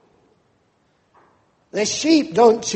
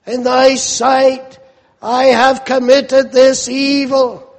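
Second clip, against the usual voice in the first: rising fervent plea, pressing louder to a cry on Oh; impassioned preaching; 3 semitones higher, 5 dB louder than usual. Acted. Preacher pleading the psalmist's prayer to God, building fervent cry. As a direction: preach it as a desperate prayer for mercy.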